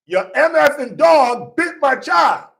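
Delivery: high, loud furious yell at the neighbors; acting out a dad screaming over his bitten child; retold scream. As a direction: scream it like the enraged father. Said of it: "He's just screaming that".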